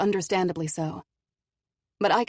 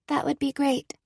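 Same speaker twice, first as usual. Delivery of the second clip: put-on higher girl voice, half smile; young girl voice; 9 semitones higher than usual. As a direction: brave little girl. Cora's girl voice.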